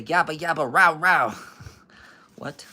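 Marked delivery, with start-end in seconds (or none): 0.0-1.3 loud, higher yabba-yabba bark; dog barking imitation. Reader voicing a growling dog, loud imitated barking. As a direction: bark it out loud in spoken syllables.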